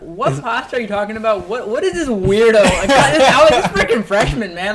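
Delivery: high, loud, laughing disbelief; mock outrage at a weird stranger; incredulous, half laughing; confused outburst. Retelling own confused reaction.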